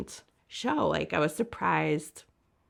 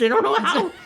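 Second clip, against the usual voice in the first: laughing; 10 semitones higher, 12 dB louder than usual. Real, not acted. Laughing at band's complexity.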